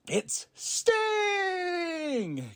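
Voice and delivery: very high, long shouted announcer call; Tony Schiavone's Sting call. Retold or imitated. Imitating Tony calling Sting's entrance with a long high shout. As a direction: belt it out like a ring announcer. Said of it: "Can't do it as good as Tony".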